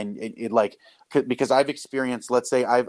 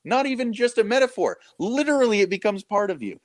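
excited emphasis; 12 semitones higher than usual. Real, not acted. Podcast guest marvels that bread and cup are material, excited and high-pitched. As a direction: voice leaping high in amazement.

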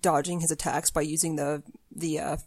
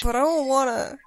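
whiny; 8 semitones higher than usual. Real, not acted. Whining refusal to friend.

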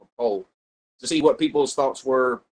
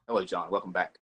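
casual; 4 semitones lower, 4 dB quieter than usual. Shown as a demonstration. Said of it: "stating casually hello".

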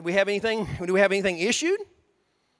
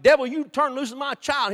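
commanding rebuke; 7 semitones higher, 7 dB louder than usual. Retold or imitated. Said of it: "you're like".

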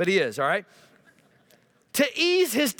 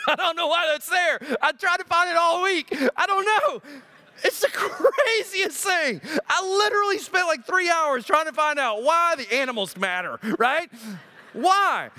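laughing bafflement; 6 semitones higher than usual. Real, not acted. Laughing at his own confusion.

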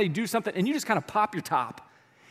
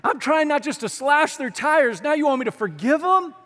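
higher, slow, whining protest; mock-exasperated complaint to Paul, higher; exasperated plea; 7 semitones higher, 4 dB louder, slower than usual. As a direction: beg Paul to ease up. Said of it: "You're like".

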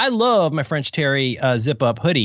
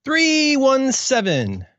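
drawn-out echo; 10 semitones higher, much slower than usual. Real, not acted. Echoes the episode number.

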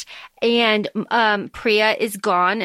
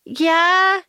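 higher, taunting and drawn out; smug taunting Karthik; 6 semitones higher than usual. Retold or imitated. Karthik taunting the stabbed Malini, lightly voiced smug taunt. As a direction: goad her like a smug villain. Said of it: "Karthik comes, like, strolling in".

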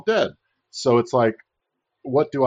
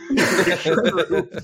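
laughing; 8 semitones higher, 3 dB louder, slower than usual. Real, not acted.

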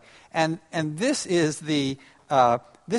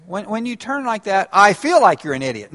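wrong I statement, mocking; 5 semitones higher, 6 dB louder than usual. Shown as a demonstration. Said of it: "that's not the way you do it".

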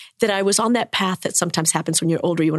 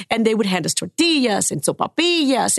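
ordinary talk, nothing marked

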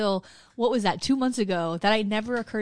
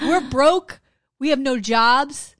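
louder, slow, indignant complaint; indignant quote; 3 semitones higher, 7 dB louder, much slower than usual. Retold or imitated. Quoting a Harlem man to a reporter, slow indignant. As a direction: spell out the hardship, slowly.

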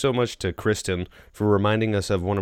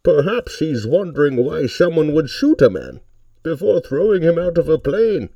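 Bane impression muffled through a cup; loud masked villain, spoken into a cup; 9 semitones higher, 6 dB louder than usual. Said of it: "I need to talk into a cup".

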